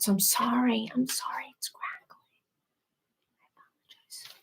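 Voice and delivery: barely audible whisper; playful whisper, shrinking away to almost nothing; whispered apology. Pretend. Whispers mock apology over audio crackle.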